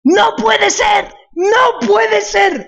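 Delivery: drawn-out, high disbelieving shouts; wrestling commentator. Real, not acted. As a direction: howl it like a ringside announcer. Killed after beating the boss.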